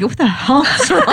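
mock alarmed partner. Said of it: "and he's like".

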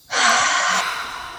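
long slow exhale; steadying breath. Real, not acted. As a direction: let the breath out slowly. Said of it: "Okay, deep breath".

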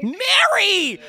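high delighted exclamation; surprised shout. Real, not acted. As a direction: shout the name in disbelief. Finally learns the name.

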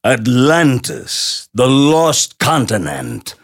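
slow booming trailer narrator; big announcer voice. Retold or imitated. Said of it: "Remember in the George Pal movie".

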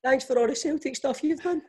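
high, laughing mock of the mate's line; laughing mock quote. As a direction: parrot the line through laughter. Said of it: "mocks the mate's line".

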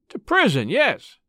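a lightly hoarse cry. A narrator's reading. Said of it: "cried the man hoarsely".

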